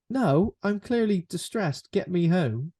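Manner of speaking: mock-distressed insistence; distressed protest. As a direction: protest as the upset passenger, acted. Acting out the distressed passenger's refusal.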